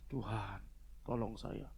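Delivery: low, softened plea to God; understated, hushed begging; imitated tearful plea. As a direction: quietly act out a weeping woman's prayer, with only a touch of sorrow. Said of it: "terseduh-seduh. Dia bilang".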